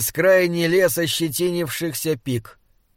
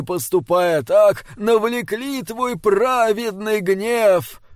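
high, slow, wailing penitential chant; fervent prayer; 5 semitones higher, slower than usual. A narrator's reading. Old bishop confesses sins in prayer, high drawn-out lament. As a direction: intone it as an old cleric begging forgiveness.

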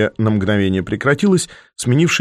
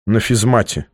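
ordinary delivery

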